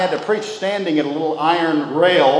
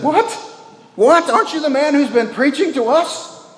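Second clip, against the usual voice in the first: much higher, louder, stunned repeated "What?"; a prisoner who can't believe what he heard; shocked disbelief; 4 dB louder than usual. Retold or imitated. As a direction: recoil in offended astonishment. Prisoner shocked by the preacher's reply.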